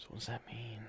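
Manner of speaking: breathy puzzled whisper; whispered aside. Real, not acted. Host puzzling over a headline, whispering to himself. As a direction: whisper it to yourself, baffled.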